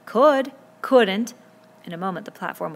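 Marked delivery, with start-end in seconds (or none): 0.0-0.5 loud, high contradiction; one-word argumentative shout; shouted retort. A narrator's reading. 0.8-1.3 loud, raised-pitch contradiction; one-word argumentative shout; shouted retort. A narrator's reading.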